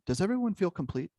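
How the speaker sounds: Paige asking. Retold or imitated. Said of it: "She spoke up ... and said".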